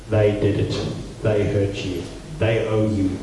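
low, soft grudging voice inside; resentful inner voice. Retold or imitated. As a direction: let the grievance whisper in your head. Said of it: "that voice has got another side".

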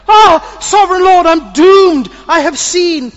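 very high, loud cry of dread; terrified cry. Acted. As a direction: wail out in terror. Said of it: "he cried out".

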